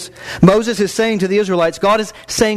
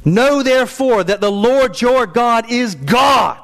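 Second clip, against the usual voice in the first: proclaiming shout; 6 semitones higher, slower than usual. Acted. Pastor proclaiming God's faithfulness to the church, slow shout. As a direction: call it out over the whole church, slowly.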